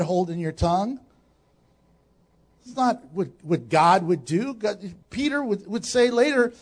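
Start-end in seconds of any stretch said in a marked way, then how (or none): none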